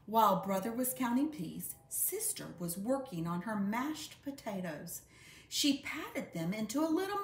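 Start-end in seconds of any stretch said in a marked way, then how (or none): none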